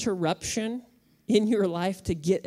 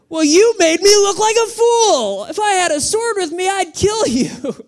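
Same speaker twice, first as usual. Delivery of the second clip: much higher, louder mock-furious rant; hammed-up fury at the donkey; angry Balaam; 12 semitones higher, 10 dB louder than usual. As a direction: blow up like a humiliated man. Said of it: "He answered the donkey".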